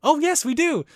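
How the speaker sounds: high, loud, eager yes; put-on bubbly eagerness; perky maid imitation. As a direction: chirp it like a maid landing a customer.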